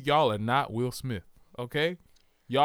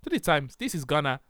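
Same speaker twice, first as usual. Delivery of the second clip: insistent mock Ghanaian accent; dubious Ghanaian accent. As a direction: defend yourself in a fake Ghanaian accent. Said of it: "that's not a Ghana accent".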